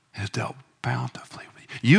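hushed whispered assurance; whispered promise. Acted. Preacher whispering the psalm's promise to the soul. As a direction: whisper it tenderly.

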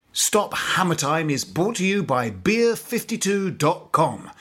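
measured, clear podcast ad voice; sponsor ad read. Acted. Host reads the beer sponsor message to listeners, upbeat ad read. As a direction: read the sponsor spot like a pitch.

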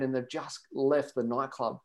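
normal talking voice

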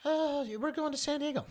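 lightly voiced exchange; retold dialogue. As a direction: ask and answer lightly mocking. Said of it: "I'm like".

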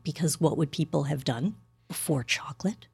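hushed, unvoiced mock horror; whisper. Real, not acted. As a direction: whisper it like a dark secret.

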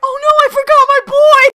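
high, loud hammed-up panic; mock panic. Mocking Din forgetting his son, high panicked shriek. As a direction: shriek it like a frantic dad.

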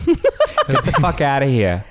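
put-on slow, laughing thick Long Island accent; Long Island goodbye. Guest saying goodbye as a Long Islander on request. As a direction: brush them off like a true Long Islander. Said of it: "as a true Long Islander would say goodbye".

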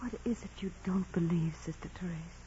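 hushed, fearful whisper. Acted. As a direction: breathe the question, barely voiced. Hushed confrontation in the convent.

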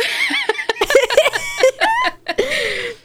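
a long, high, squeaky giggle; high squealing laugh. Real, not acted. Laughing hard at her own trivia fact. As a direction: burst out in a high laughing fit.

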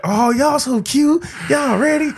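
high, sing-song praise; cooing bystander voice. Retold or imitated. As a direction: gush up high like strangers. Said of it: "everybody's like".

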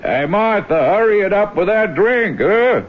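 high-pitched call to the next room. Acted. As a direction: holler casually for her, pitch climbing to the end. Calls wife in another room.

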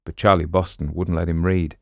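ordinary delivery

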